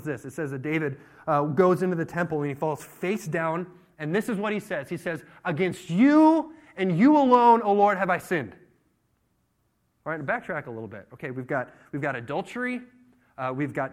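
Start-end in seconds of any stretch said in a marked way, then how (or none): 5.4-8.5 loud, high, slow cry to God; impassioned confession. Acted. David face down in the temple, impassioned confession. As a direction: draw out YOU, full voice.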